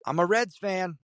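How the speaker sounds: mild, high-pitched retold yell; mock yell to someone pulling away. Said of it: "So I just yell".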